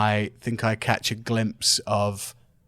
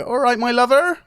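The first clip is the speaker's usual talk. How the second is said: cheery greeting; 12 semitones higher, 4 dB louder than usual. Acted. Greeting Audrey in character voice.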